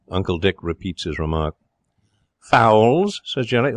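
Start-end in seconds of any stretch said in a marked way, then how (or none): 2.4-3.2 sneering rising question; scornful echo. Acted. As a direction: spit the word back with contempt. Jerry scornful at uncle.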